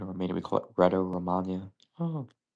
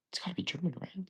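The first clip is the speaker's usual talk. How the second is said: whispered; 10 semitones higher, 11 dB quieter, faster than usual. Real, not acted. Muttering trivia guess to himself.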